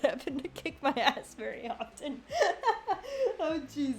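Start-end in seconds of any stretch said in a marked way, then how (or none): 2.3-4.0 laughing. Real, not acted. Laughing at teammate's bad luck.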